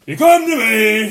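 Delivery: loud, high, drawn-out film-star bluster; Al Pacino impression. Player confronting Meslier, hammed-up Pacino impression. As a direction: stretch it out and boom it. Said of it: "recording as Al Pacino".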